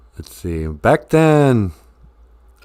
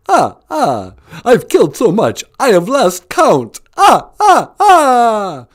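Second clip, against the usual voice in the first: much higher, louder gloating vampire count; 10 semitones higher, 6 dB louder, slower than usual. Retold or imitated. Host voicing the Count boasting about his kills, gleeful and loud. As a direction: play the Count crowing over his victims. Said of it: "you keep forgetting that Count is a, uh, vampire".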